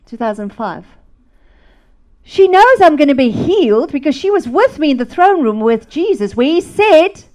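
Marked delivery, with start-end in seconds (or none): 2.2-7.2 loud, raised, ringing conviction; fervent proclamation. Retold or imitated. Reading his letter on healing, loud fervent proclamation. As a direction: declare it high and forceful, like preaching.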